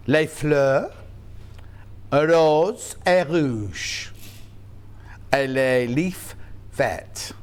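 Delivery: put-on slow schoolbook French in a Yorkshire accent; hammed-up French, Yorkshire accent. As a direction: sound out the French slowly, Yorkshire vowels. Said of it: "speaks French with a Yorkshire accent".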